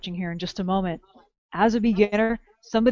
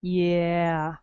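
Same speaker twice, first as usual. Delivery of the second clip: usual speaking voice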